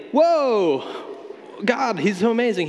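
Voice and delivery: high, bright-eyed gushing whoa; mock amazed whoa. Shown as a demonstration. Preacher mocks a wrong reading, amazed. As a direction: gush like you're dazzled by God. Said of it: "he's not saying".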